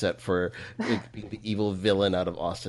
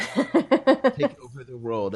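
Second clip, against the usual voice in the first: mad scientist; 3 semitones higher, 6 dB louder, much slower than usual. Retold or imitated. Echoing villain line.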